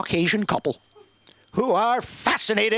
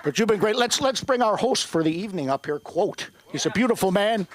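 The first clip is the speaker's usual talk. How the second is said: normal talking voice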